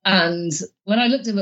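usual speaking voice

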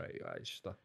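a quick half-whispered aside to himself; mock whisper. Diner at a fake ramen place mutters in a mock whisper. Imagined diner's thought at kebab shop.

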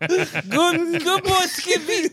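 put-on high, slow good-boy praise; praising a pet. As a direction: coo at the kangaroo like a pet. Praising the kangaroo.

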